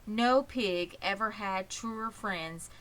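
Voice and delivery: plain reading voice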